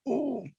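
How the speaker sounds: high, soft "ooh"; mock recoil from a strong taste; small high-pitched ooh; imitated taste reaction. Imitates first taste of Vegemite.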